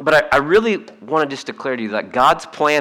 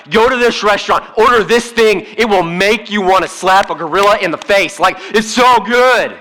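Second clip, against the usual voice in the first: higher, louder hype about a restaurant; mock yelling excitement; 6 semitones higher, 3 dB louder than usual. Preacher imitating a congregant raving about food, gushing. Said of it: "you'll yell to the mountains".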